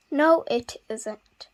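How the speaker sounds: plain reading voice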